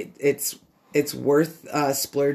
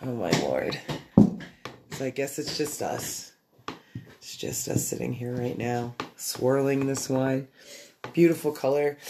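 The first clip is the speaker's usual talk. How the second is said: normal talking voice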